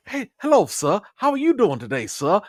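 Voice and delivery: high, eager, hammed-up subservience; mock a fawning 1800s servant greeting the boss; servile slave caricature. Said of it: "the way that they really wanted".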